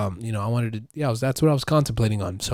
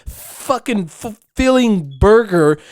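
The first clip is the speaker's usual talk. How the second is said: slow emphatic; 10 semitones higher, 7 dB louder, much slower than usual. Real, not acted.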